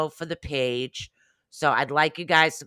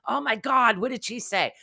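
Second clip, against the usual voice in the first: alarmed gasp; 9 semitones higher than usual. Pretend. Viewer panicking over missed info.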